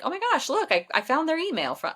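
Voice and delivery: excited discovery. Retold or imitated. Wife retells husband's discovery.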